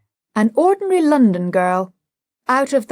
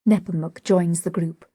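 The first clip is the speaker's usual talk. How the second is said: ordinary delivery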